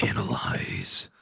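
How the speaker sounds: low whispered command; whispered spell. Acted. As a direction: whisper the spell word slowly. Casting analyze spell.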